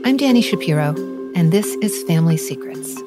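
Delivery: ordinary delivery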